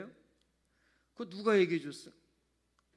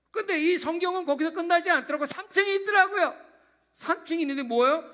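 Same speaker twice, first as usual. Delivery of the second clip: emphatic preaching; 8 semitones higher, 8 dB louder than usual. Acted.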